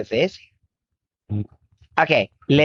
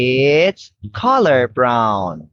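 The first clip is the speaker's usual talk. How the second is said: put-on reading to a toddler; 4 semitones lower, slower than usual. Shown as a demonstration. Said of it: "models answer for child".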